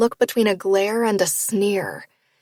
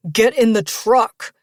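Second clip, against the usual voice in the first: tight order; 4 dB louder, slower than usual. Acted. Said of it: "he orders her, his tone tight".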